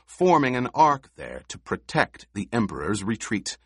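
plain reading voice

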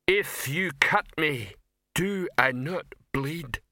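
wounded troll, drawn out; declaiming troll. Acted. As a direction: plead your case like a ponderous stage tragedian.

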